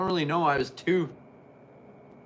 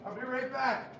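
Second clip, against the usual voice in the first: far off; 10 semitones higher, 16 dB quieter than usual. Real, not acted. Calling from another room.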